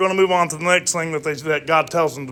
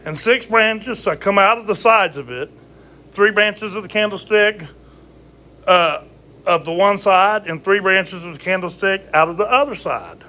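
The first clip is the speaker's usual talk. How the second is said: scripture reading; 4 semitones higher than usual. Acted. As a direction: read the verse like scripture. Reads scripture aloud.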